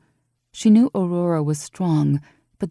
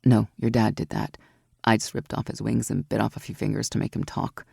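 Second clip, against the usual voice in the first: lower, cool confident voice with a grin; Aurora, mischievous; 6 semitones lower than usual. A narrator's reading. Aurora teasing Evie about her climbing skill, lower and sly. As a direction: drop low and sound smug about the climb.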